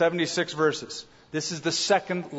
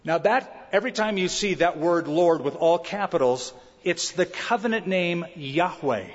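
ordinary talk

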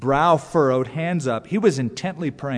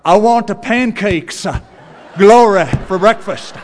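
preacher voice; 6 semitones higher, 10 dB louder than usual. Retold or imitated. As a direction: order pancakes like preaching from a pulpit. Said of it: "nobody talks to people that way".

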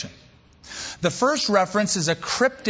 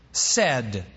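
"said" repeated on its own, falling in pitch; stress on said; 5 semitones lower than usual. Shown as a demonstration. Preacher repeating the marked word, "said" firmly stressed. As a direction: set "said" down low and deliberate. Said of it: "I'm emphasizing the word on purpose".

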